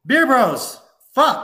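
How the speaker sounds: loud, high shouted cheer; frat bro cheer. Acted. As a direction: yell it like a frat toast.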